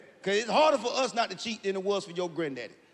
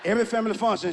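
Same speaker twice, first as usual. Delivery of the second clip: ordinary talk, nothing marked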